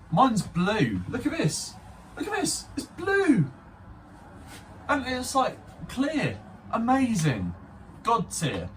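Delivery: high, squealing show-off delight; giddy excitement. Real, not acted. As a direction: gush over it in a high pitch. Gushing over own blue Beyblade.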